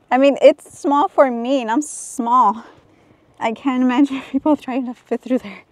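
breathless, panting between words; out of breath. Real, not acted. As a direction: talk while catching your breath. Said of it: "I'm already out of breath".

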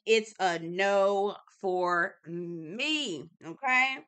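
very slow, stretched out no; drawn-out no. Shown as a demonstration. Vlogger drags out her verdict to viewers, very slow. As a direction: drag out every word of the refusal.

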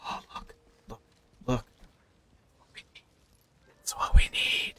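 hushed, breathy whisper to himself. Real, not acted. Gamer whispering at a loot find, hushed.

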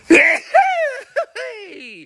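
sudden loud explosive sneeze; a burst of a sneeze mid-sentence; big sneeze. Real, not acted. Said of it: "That was a big sneeze".

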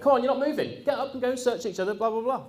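chivvying the sitting class; impatient trainer. Retold or imitated. Trainer urging the seated trainees, high and impatient. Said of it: "he went".

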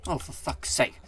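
ordinary delivery

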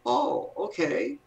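high, slow, rising acceptance; credulous brain. Acted. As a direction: play a naive brain readily agreeing. Brain credulously agreeing, voiced as character.

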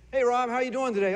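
upbeat friend checking in; cheery greeting. Retold or imitated. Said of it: "friend greets the speaker".

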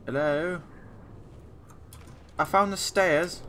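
light mock British accent, slow on the stairs line. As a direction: play a hint of a posh Brit. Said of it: "I always go the British accent".

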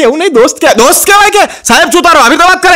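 high-pitched mock exchange; acted classroom squabble. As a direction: imitate pupil and teacher bickering, pitch jumping up. Classroom back-and-forth with teacher.

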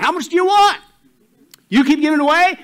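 high, loud, exuberant acted offer; hammed-up eager giver, voice way up; excited offer. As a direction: burst out with delighted generosity.

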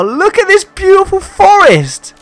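awed delight. Real, not acted. Player admiring a forest on a red planet, awed. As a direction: stretch it out in amazement.

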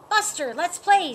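bright, loud call to play; eager call. Acted. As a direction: Phoebe pleading with Buster to come play, eagerly. Dog begging friend to play.

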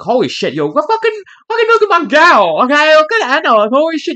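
high, loud, amazed fan squeal; excited gushing. Retold or imitated. Fan reacting to the cover art, high loud excitement. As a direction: gush over the art like a stunned fan. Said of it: "and I'm like".

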